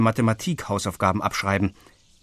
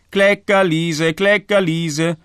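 put-on much higher, louder, slow singsong child; crowing little boy; 7 semitones higher, 5 dB louder, slower than usual. A narrator's reading. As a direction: crow the nickname like a teasing toddler. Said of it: "krähte David noch immer". (Kleinkind kräht neckend im Singsang)

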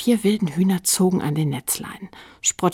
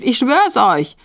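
high, loud protest; calling out; 6 semitones higher, 9 dB louder, slower than usual. A narrator's reading. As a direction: swear it loudly to the others. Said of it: "rief Stief". (laut beteuernd gerufen)

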